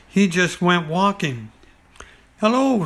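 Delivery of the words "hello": high, small piglet voice; Piglet's little voice. Acted. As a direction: squeak it like a tiny piglet. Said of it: "said Piglet".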